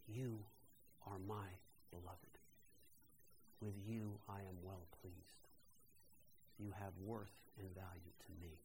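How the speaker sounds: tender blessing. Retold or imitated. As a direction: speak it like a loving benediction. Husband's loving words replayed.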